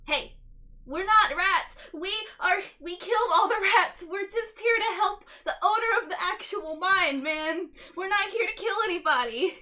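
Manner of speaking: loud, earnest plea to the dwarves; pleading shout. Acted. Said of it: "I talk in dwarf".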